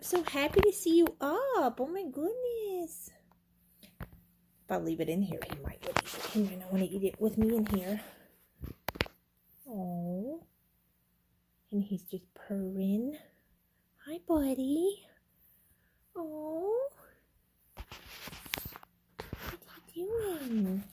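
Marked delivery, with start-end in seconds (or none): 0.0-3.1 pet voice. Real, not acted. Cooing to cat. 14.1-16.9 pet voice. Real, not acted. Cooing to cat.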